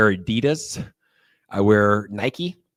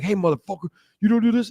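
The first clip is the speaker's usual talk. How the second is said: tough guy voice; 8 semitones higher, 3 dB louder than usual. Retold or imitated. Thug voiced in story.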